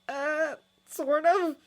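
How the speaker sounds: high, slow reluctant hedging; stall, not wanting to say; nervous twin. Acted. Fred reluctantly admitting to Harry, nervous slow hedge.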